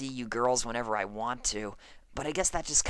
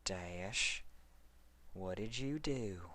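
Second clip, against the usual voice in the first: low suspicious; 6 semitones lower, 13 dB quieter, slower than usual. Acted. Applejack suspicious of Dash.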